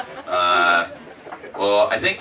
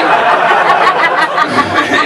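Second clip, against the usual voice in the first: high cackle; 14 semitones higher, 5 dB louder than usual. Real, not acted. Laughing at Durant photo.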